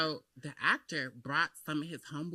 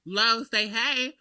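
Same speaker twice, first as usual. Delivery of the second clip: high, loud, stretched-out call; imitated pushy friend; 7 semitones higher, 8 dB louder, much slower than usual. Guest imitates friend urging a greeting, loud and slow. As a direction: mimic a friend loudly coaxing, very drawn out.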